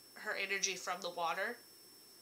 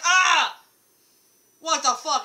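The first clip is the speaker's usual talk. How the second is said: much higher, louder jolted-awake cry; startled yell; 7 semitones higher, 14 dB louder, slower than usual. Retold or imitated. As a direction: jump out of sleep shouting.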